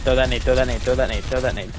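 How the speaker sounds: loud, urgent repeated command; urgent callout. Acted. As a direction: bark it like a shotcaller. Coach urging player to throw.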